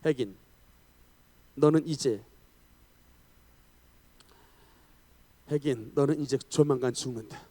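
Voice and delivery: lightly voiced devil, low and measured; ominous low address with long pauses; devil's threat. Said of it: "마귀가 뭐라고 말을 거냐면".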